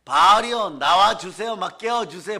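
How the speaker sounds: hammed-up desperate wail to an idol; mock frantic crying out. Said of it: "으악 소리 지르면서 이런 거죠". (우상에게 필사적으로 외치는 흉내)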